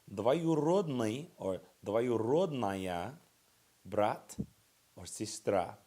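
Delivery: Двоюродный said very slowly, syllable by syllable; teacher slowly models Двоюродный брат for students; slow model pronunciation. Shown as a demonstration. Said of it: "that's a big mouthful".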